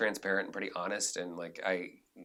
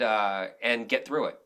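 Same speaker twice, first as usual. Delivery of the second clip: ordinary talk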